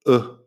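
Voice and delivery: a clipped short U vowel. Shown as a demonstration. Teacher demonstrating the short vowel, short U. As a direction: say U short, like in would. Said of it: "es como una U corta".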